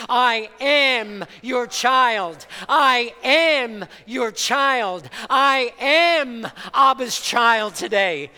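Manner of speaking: with conviction. Shown as a demonstration. Pastor leading the congregation to declare it, loud and tearful. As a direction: proclaim it like you truly believe it. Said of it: "say it like you really believe it".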